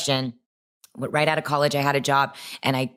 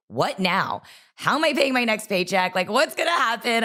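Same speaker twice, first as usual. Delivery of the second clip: higher, anxious rush of worried questions; retold panic; 7 semitones higher than usual. Just quit her job, panicking about her next paycheck, higher anxious voice. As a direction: voice climbing as the panic sets in. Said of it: "I was like".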